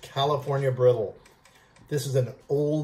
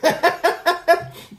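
bursting high laugh; 14 semitones higher, 4 dB louder than usual. Real, not acted. Laughing at the mouthful.

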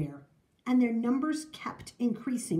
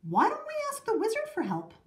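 high, quick call; 12 semitones higher, faster than usual. A narrator's reading. Amanda suggesting help at town meeting, high call. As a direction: pipe up over a crowd, pitched high. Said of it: "Amanda called out".

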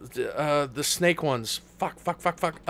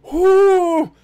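loud whoop; 10 semitones higher, 10 dB louder than usual. Real, not acted. Whoops in the middle of rant.

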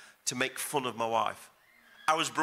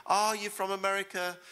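lightly mimicked stranger, higher voice; imitating a local; 7 semitones higher than usual. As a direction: play the curious foreign guy asking, pitched up. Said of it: "this guy said to him".

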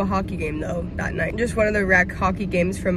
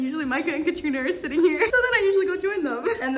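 much higher, fast, laughing; 8 semitones higher, 3 dB louder, faster than usual. Real, not acted. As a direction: burst into giggles, quick. Showing friends, laughing.

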